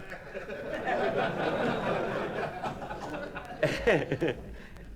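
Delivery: high airy chuckle; breathy laugh. Real, not acted. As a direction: laugh quietly at your own joke. Laughs at own joke.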